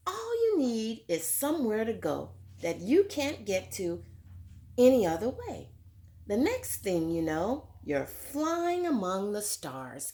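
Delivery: lively storybook read-aloud; reading to children. A narrator's reading. Teacher reading a picture book to children, lively. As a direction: read it like story time for little kids.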